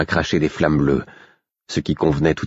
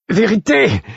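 high desperate shout calling Vérité; cry out; 4 dB louder than usual. A narrator's reading. Narrator calling his king across the mind, a desperate shout. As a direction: call out to Vérité, much higher and louder. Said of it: "criai-je".

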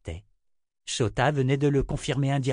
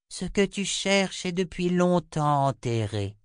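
high, measured seer voice; Chota, oracular; 8 semitones higher than usual. A narrator's reading. Chota finally speaks to the waiting sourcier, high oracular voice. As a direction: pronounce it high like a prophecy.

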